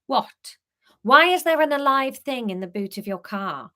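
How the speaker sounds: understated irritation; restrained grumpiness. Acted. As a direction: keep it just short of grumpy. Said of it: "keep her voice not too grumpy".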